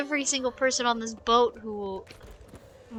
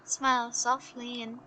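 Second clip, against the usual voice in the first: softer, measured storyteller; story narration; 8 dB quieter than usual. A narrator's reading. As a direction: let the tale unfold in hushed, even phrases. Reads game story aloud.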